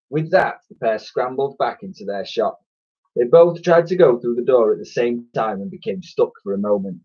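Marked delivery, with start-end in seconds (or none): none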